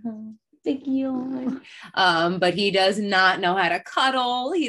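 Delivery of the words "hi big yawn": higher slow cooing; high puppy talk. Real, not acted. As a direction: coo up high at the pup.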